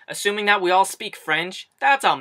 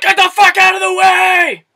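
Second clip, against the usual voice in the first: much higher, much louder furious shout; imagined yell; 13 semitones higher, 12 dB louder than usual. Retold or imitated. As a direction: yell at the shoppers blocking the aisle. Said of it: "You just wanna be like".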